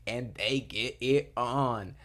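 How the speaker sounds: loud, slow, drawn-out delivery; relishing emphasis. Real, not acted. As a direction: land each word with juicy relish. Relishing the favorite romantic moment.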